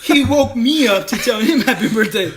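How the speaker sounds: laughing. Real, not acted. Laughing to his cohost about being woken up, amused. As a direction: crack up while telling it.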